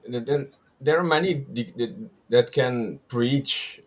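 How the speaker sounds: real stammer while searching for words; stuttering. Real, not acted. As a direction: stumble over the words, restarting. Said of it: "I'm stuttering".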